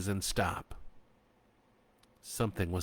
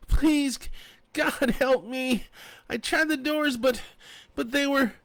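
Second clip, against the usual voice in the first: high, loud, stumbling plea; desperate shouting; 6 dB louder than usual. A narrator's reading. Trapped woman begging the sheriff to help. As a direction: shout and beg, tripping over words. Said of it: "she shouted".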